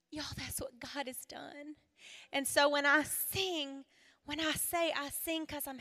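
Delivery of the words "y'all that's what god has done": breathy awed whisper; hushed whisper. Real, not acted. Speaker telling the ladies of God's work, in a hushed whisper. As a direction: whisper it like a secret blessing.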